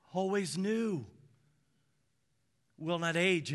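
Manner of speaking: ordinary talk, nothing marked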